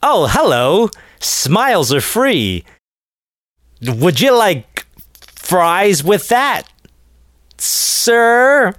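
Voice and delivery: high, slow fast food cashier voice; bored fast food cashier. Pretend. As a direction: play the drive-thru worker, slowly. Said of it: "coming on the show practicing".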